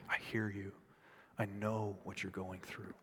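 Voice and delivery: very low, hushed comforting voice; gentle near-murmur of assurance; tender reassurance. Acted. Voices God comforting the hurting.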